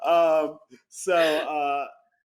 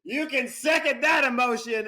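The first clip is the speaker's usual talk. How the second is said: mock scream; 9 semitones higher, 3 dB louder than usual. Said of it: "Mary Scheer would scream".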